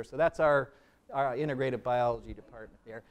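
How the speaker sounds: usual speaking voice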